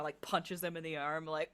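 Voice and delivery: ordinary talk, nothing marked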